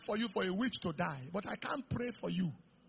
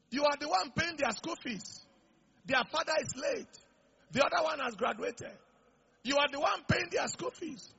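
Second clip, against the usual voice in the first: retold insistence; 8 semitones higher than usual. Wife insists, retold scolding.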